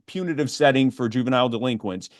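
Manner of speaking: ordinary talk, nothing marked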